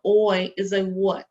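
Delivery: usual speaking voice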